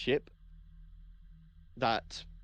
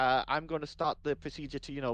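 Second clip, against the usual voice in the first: normal talking voice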